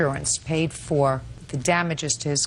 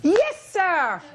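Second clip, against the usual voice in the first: emphatic judge; 8 semitones higher, slower than usual. Real, not acted. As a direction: drive him to the answer, then triumph. Judge pressing defendant to answer.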